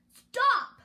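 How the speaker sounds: high, loud furious stop; angry. A narrator's reading. Cinderella interrupting the fairy godmother, angry shout. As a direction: bark it in outrage. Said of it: "said an angry voice".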